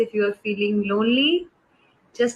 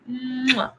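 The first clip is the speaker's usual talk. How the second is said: blown kiss; 3 semitones higher than usual. Shown as a demonstration. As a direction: blow a kiss to the viewers. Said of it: "you get a kiss like this".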